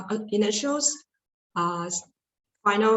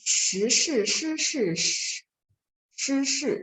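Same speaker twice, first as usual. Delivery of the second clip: slow, high SHI syllables, each with its own tone; tone recitation; 6 semitones higher, slower than usual. Shown as a demonstration. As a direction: recite every SHI deliberately, raised and tonally distinct. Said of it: "now I'll read you".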